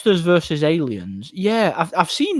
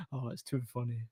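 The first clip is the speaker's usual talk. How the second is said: ordinary delivery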